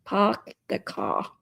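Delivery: put-on slow, playful Boston accent. Streamer tries a Boston accent for chat, slow Boston accent. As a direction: say it slowly in a Boston accent, dropping the r's. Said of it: "love a Boston accent".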